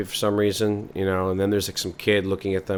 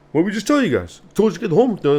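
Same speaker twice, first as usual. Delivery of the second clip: much higher, louder scolding; angry father; 12 semitones higher, 6 dB louder, faster than usual. Acted. Father catching kids still in garage, loud. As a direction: tell the kids off.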